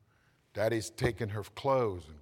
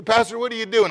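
loud, high imitated congregant; mock puzzled listener; 11 semitones higher, 13 dB louder, faster than usual. Congregant asking the pastor what he is doing, mock puzzled. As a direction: play the confused churchgoer. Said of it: "You say".

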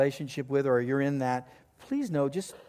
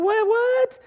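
much higher and louder, a squeaky "What, what?"; incredulous high cry; 6 dB louder than usual. Retold or imitated. Husband reacting to wife's news, high and surprised. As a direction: yelp it in comic disbelief. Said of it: "I'm talking like this to Annette".

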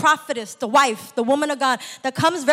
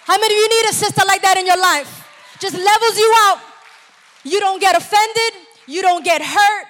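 higher and much louder, fast; preaching at full force; 5 semitones higher, 6 dB louder than usual. Real, not acted. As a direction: shout it to the whole church. Preacher at peak, calling out.

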